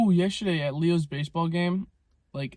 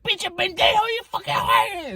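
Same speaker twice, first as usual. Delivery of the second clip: very high, loud hammed-up screech, slowing on the insult; mock grandma screaming; 5 dB louder than usual. Vlogger imitates the grandma cursing the new lady, high mock screech. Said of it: "she was like".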